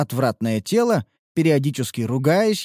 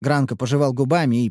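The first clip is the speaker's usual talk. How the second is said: plain reading voice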